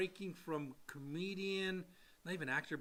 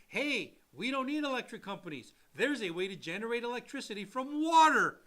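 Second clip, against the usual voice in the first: mock Tesla pitch; 4 semitones higher, 6 dB louder than usual. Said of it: "Like crazy".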